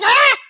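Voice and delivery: sharp, high, loud scream of fright; startled scream. Retold or imitated. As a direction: scream as if jumped from behind. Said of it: "I'm like".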